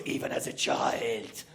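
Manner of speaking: low, hushed, slow confiding; mother revealing a secret, whispered. Retold or imitated. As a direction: breathe it like a family secret. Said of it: "She goes".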